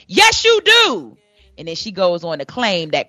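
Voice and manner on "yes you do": high, loud, drawn-out shout; stretched-out mock holler, pitched way up; retold yell back. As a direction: imitate an insistent fan yelling back. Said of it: "she yells back".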